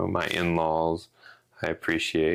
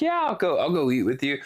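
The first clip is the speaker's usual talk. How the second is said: cheerful agreeing; 8 semitones higher than usual. Retold or imitated. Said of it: "I'd be like".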